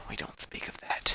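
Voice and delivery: mock-ominous hushed aside; a dramatic whisper, as if the topic is taboo. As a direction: whisper it like a forbidden secret. Joking about a banned topic.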